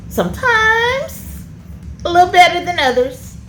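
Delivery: teasing about the son; wry. Real, not acted. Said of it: "wry teasing about son".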